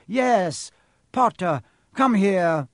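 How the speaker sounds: loud, high command; rounding on someone. A narrator's reading. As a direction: order the boy over sharply. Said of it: "Quirrell rounded on Harry".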